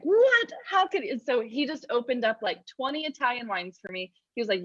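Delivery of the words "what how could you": high, loud, incredulous outburst; shocked disbelief. Retold or imitated. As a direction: act out the friend's stunned disbelief.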